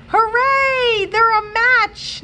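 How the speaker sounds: high, loud, gleeful cheer, slowing on the match; excited cheer. Acted. Novalee cheers as the rabbits become friends, overjoyed shout. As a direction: burst out in joy for the rabbits.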